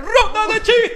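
high, loud game-show call; shouted announcement. Acted. Host restarting the joke round, shouted high and loud. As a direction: belt out the segment title.

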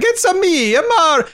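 a hammed-up Nintendo Mario; Mario impression. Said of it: "a Mario from Nintendo accent".

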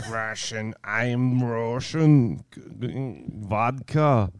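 slow, laboured bad Russian accent. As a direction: grope your way into a Russian accent, word by word. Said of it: "this is Russian".

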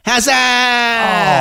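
high, drawn-out celebratory yell; long, high imitated cricket cry; imitating excited toddler's cry. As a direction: mimic a small child yelling at a kicked ball. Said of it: "every time she kicks the ball, she goes".

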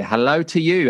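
normal talking voice

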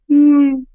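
high, helpless giggling fit; high giggle. Real, not acted. Laughing at the memory of the crying face, high giggle. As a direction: giggle up high, can't stop. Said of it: "giggle, like, laugh at him".

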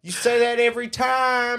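high mock-exasperated holler. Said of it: "And the rednecks yell back".

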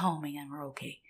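soft, low-key sigh of relief; retold relief, said under the breath; relieved. Passengers settling after turbulence, quietly relieved.

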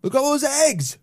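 high, excited voice. Real, not acted. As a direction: gush over the eggs. Excited at the eggs.

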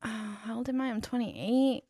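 ordinary delivery

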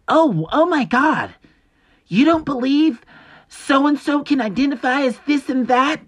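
high, loud, slow shocked whine; mock outraged critic. Mocking a critic shocked by his views, high outraged whine. Mocks critics calling him misogynist.

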